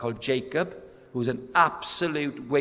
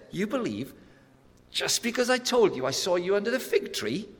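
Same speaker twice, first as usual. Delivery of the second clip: Jesus questioning; 8 semitones higher than usual. Retold or imitated. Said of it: "And Jesus says".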